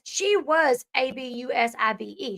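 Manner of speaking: slow, each letter A B U S I V E said apart; spelled out. Real, not acted. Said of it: "spelling it out".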